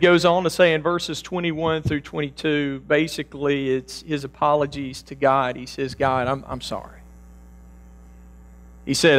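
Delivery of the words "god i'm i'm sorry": soft, lowered sorry to God; hushed apology to God; quiet apology. Acted. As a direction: drop the voice in contrition.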